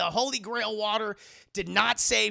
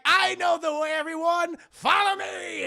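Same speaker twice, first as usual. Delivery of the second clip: much higher, louder call to the group; hammed-up rallying shout; 9 semitones higher, 5 dB louder than usual. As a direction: lead the charge pompously. Marcus Brody rides off.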